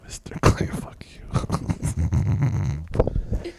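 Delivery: forceful stage whisper; harsh, mock-offended whisper; whispered podcast voice. Shown as a demonstration. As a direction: hiss back a playful curse. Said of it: "Talk like this".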